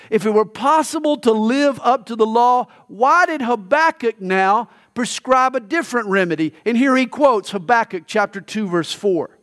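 normal talking voice

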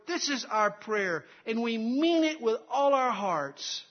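loud, high pulpit proclamation; full-force preaching. Real, not acted. Preacher explaining the amen to the congregation, full-force preaching.

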